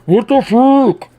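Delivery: slow, high, loud impression; mock character voice. Streamer quoting Evil Orbs Man, exaggerated character impression. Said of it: "he's like".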